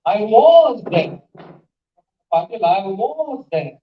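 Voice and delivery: "was" stretched out, high and loud; slow, emphatic swoop on "was"; arguing, stress on WAS. Shown as a demonstration. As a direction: insist on "was" to contradict the listener. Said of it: "She wants to argue".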